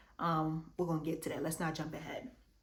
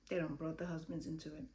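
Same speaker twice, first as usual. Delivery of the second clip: quiet aside; 2 semitones lower, 8 dB quieter, faster than usual. Shown as a demonstration.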